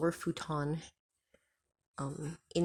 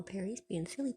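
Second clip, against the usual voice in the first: normal talking voice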